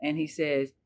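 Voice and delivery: usual speaking voice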